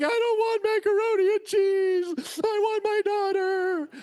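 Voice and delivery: high-pitched wail, hammed up; mock bawling. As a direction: imitate bawling like a heartbroken parent. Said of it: "You know, I'm bawling".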